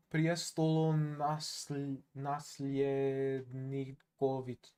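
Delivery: "Priestolo Nasl-, Nasljednikovic-" slowly broken into careful syllables; sounding it out. Shown as a demonstration. Streamer slowly sounds out a viewer's long name. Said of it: "sounding out a long name".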